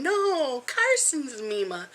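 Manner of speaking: put-on high, slow insistent little-kid voice; imitating a toddler. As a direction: lightly play the stubborn grandson. Grandma imitates grandson laughing back.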